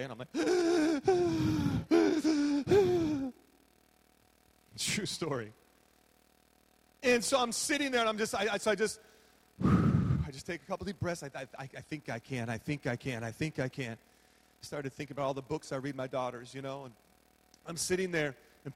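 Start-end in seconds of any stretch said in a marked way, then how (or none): 0.4-3.3 sharp high gasp of panic; a loud panicky gasp, higher; panicked gasp. Retold or imitated. Panicking in stuck elevator. 11.2-14.0 self-soothing mantra. Retold or imitated. Calming self, little engine book.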